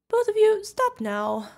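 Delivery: loud, high-pitched reprimand; exasperated scolding. Acted. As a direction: call both companions sharply to order. Scolding two teasing companions.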